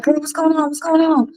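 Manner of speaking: high, soft, put-on voice for girl; mock texting sister. To her sister over text, in a high put-on voice. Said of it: "I'm like".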